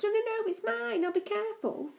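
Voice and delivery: put-on high, pleading little boy clinging to his toy; childish cry. Max begs Fred to let him keep the torch, high and pleading. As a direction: insist like a small child who won't let go. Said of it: "cried Max".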